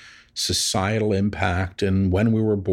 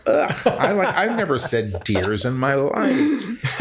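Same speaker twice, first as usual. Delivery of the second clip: high-pitched protest breaking into laughter; groan, then laugh through the complaint; amused distaste; 5 semitones higher than usual. Real, not acted. Channeler disowning the guide's word dears, laughing in exasperation.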